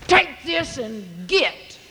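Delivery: a gruff, slow bark from the blacksmith; gruff Wicked John. Acted. Blacksmith shooing a customer off, mildly gruff. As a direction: toss it at the customer, slow and curt. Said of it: "that's the way he talked".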